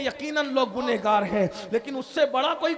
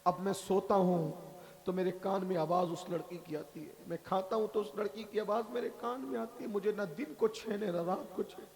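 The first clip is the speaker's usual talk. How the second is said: soft, lower pleading confession; pleading sinner; 5 semitones lower, 7 dB quieter than usual. Acted. Young man to the Prophet, soft remorseful plea. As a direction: beg for help in quiet shame.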